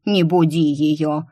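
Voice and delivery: slow mock-stern hush; imitating a protective voice. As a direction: play a stern guardian, slowly. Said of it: "а она". (передразнить строгое «Не буди её!» медленно)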